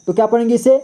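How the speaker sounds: ordinary talk, nothing marked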